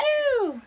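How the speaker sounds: pew sound effect. Pretend. Mom making toy gun noise.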